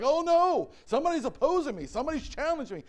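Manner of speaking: high, hurried mock alarm; mock panic. Preacher voicing people facing opposition, mock panic. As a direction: play at panicking, voice jumping up. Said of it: "Panic sets in".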